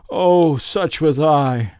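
the ghost sighing over its wasted life; drawn-out lament. A narrator's reading. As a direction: stretch it out in sorrow.

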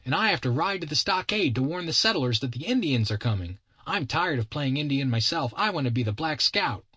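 put-on high-pitched boy announcing his game; insistent kid. Kid explains his scout game to his captor, high insistent child voice.

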